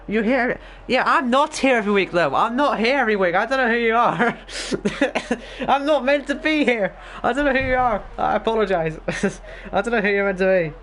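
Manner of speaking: high-pitched, laughing self-defence; laughing protest. Real, not acted. Streamer telling a viewer he doesn't know them, laughing protest. Flustered, laughing reply to chat.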